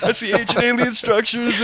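playful seer voice; mock vision. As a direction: narrate a vision dramatically. Playing the scene.

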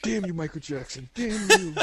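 mock curse. Cursing in mock rage.

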